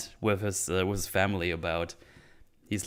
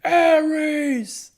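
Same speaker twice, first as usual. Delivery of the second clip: mock scream, much higher and louder, laughing through it; 5 dB louder than usual. Bob calling out the name, laughing mock scream. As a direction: belt the name out in a hammed-up scream. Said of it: "Bob screaming".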